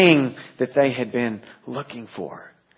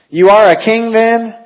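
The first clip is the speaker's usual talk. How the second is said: much higher, loud incredulous Pilate; Pilate's question; 10 semitones higher, 11 dB louder than usual. Acted. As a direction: toss it up in disbelief. Pilate voiced in reading.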